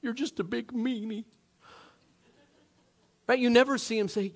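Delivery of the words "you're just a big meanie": put-on childish whining. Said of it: "what does he say about it".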